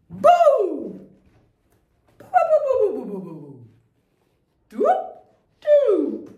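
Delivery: high loud bouh calls; playful noises. Shown as a demonstration. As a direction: bark out short boos. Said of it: "faire des petits bruits".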